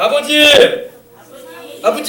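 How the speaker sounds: demonstrated prayer call; calling on God. Shown as a demonstration. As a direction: lead them in crying out "Father". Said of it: "해봅시다".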